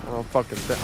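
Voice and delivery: normal talking voice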